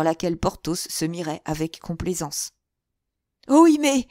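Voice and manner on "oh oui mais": high, very loud cry of dismay; sudden theatrical outcry, pitch leaping up; exclaiming. A narrator's reading. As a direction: wail out in shocked dismay. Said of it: "s'écria le cardinal".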